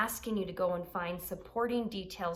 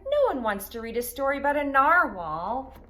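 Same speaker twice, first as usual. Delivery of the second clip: Unicorn scoffing; 9 dB louder than usual. A narrator's reading. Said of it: "said Unicorn".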